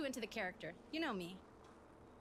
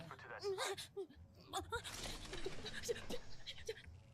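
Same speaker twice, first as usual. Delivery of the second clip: nervous laugh; 7 semitones higher, 4 dB quieter than usual. Retold or imitated. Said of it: "They're always like".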